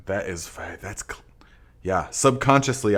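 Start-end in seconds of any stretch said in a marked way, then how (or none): none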